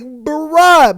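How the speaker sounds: loud, high mock exasperation; a big disbelieving bruh; playful outrage at a comment; exasperated bruh. Reacting to funny comment.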